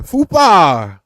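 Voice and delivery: drawn-out loud shout of FUPA; excited shout. Real, not acted. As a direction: belt it out on a long falling note. Excited chant of a catchword.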